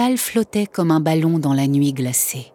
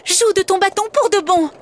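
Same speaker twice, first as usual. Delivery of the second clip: put-on higher pleading child's shout; girl crying out. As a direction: urge him on desperately. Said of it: "lui cria-t-elle".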